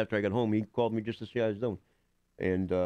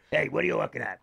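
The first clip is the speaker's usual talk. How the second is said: higher, gruff mock-tough Seymour voice; Seymour Lustig voice; 5 semitones higher than usual. Actor bumping travellers' luggage, gruff Seymour voice. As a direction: bark it like a rude Florida old-timer. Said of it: "I still love doing the voice".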